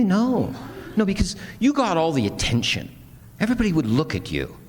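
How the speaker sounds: high-pitched retold protest; acting out a sibling's jealous complaint; protesting sister. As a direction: insist on it, pitched well up. Voices sister's protest in retold quarrel.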